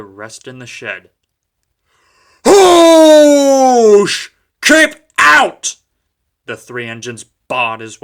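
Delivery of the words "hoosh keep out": very loud high yell; shouting. A narrator's reading. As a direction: bellow him away. Engines barring Duck's way.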